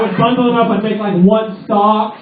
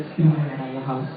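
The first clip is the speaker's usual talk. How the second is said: mock nagging question; 6 semitones lower, 9 dB quieter, faster than usual. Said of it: "that bullshit question that every guy gets".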